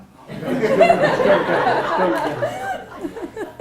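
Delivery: big high burst of laughter; rising, delighted laugh; hearty laugh. Real, not acted. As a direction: laugh out loud at yourself. Big laugh at own story.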